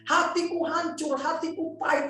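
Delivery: acted tearful anguish. Betrayed wife tells pastor of husband leaving, acted anguished wail. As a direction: pour out heartbreak as the crying wife, pitched high. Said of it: "Dia nangis".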